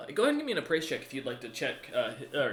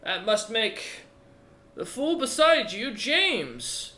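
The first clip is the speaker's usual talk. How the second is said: put-on old dwarf chieftain; 6 semitones higher, 7 dB louder than usual. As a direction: taunt them like a battered old warrior. Odger keeps talking.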